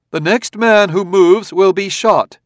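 loud, high ringing command; commanding boom. A narrator's reading. Robot warning the crowd, loud command. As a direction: freeze the crowd with one loud order. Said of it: "his voice was suddenly decibels higher".

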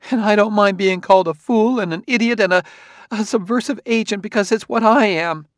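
high, loud, agitated woman; bitter self-reproach. A narrator's reading. Wife calling herself names before husband and robot, bitterly agitated. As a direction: pile up the insults against yourself, high.

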